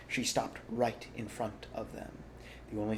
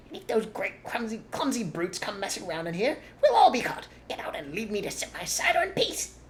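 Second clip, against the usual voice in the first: higher, louder slurring rat; drunk rat; 10 semitones higher, 6 dB louder than usual. A narrator's reading. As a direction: grumble like a tipsy rat.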